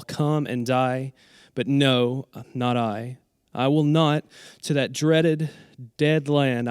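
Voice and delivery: measured dramatic verse with deliberate pauses; poem recitation. Acted. Reading a sonnet they wrote about sleep, slow dramatic recitation. Reads own sonnet aloud.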